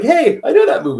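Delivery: high, loud playful call; spotting his own move in the ring; delighted recognition. Retold or imitated. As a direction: call out to the nephew proudly. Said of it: "And I was like".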